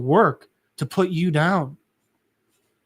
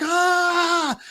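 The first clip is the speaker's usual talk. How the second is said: high, sustained mock scream of pain; held high wail, kept in check; imitated scream; 13 semitones higher than usual. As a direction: mimic a long scream without full volume. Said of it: "he's just screaming".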